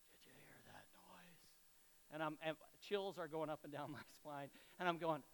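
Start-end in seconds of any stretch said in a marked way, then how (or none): 0.1-1.4 eerie hushed whisper, very faint; creepy whisper. Retold or imitated. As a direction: whisper it like a spooked wife at night. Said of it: "in this creepy voice".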